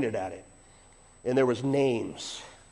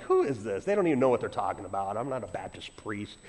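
bad attitude; 5 semitones lower, 4 dB quieter than usual. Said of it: "with a bad attitude".

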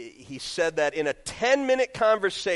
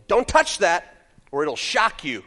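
slightly raised, loud, slow warning; mock warning; 5 semitones higher, 9 dB louder, slower than usual. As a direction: imitate a parent warning a child sharply. Retold warning to a child.

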